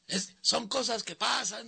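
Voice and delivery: put-on high, slow drunken catchphrase; drunk heckler. Drunk fan repeating the catchphrase, high and slow. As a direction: drawl the slogan like a drunk fan. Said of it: "Y luego decía".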